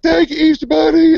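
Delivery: put-on high, loud squeaky child; little kid voice. Retelling the commercial, high little kid voice. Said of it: "little kid".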